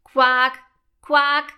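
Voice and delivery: short, loud quacking calls; frog croak. A narrator's reading. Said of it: "macht es in dem Moment". (quakender Frosch)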